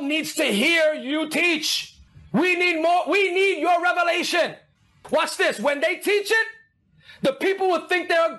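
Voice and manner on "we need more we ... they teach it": ordinary talk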